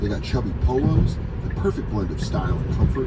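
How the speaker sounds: ad read. Acted. Ad read in show.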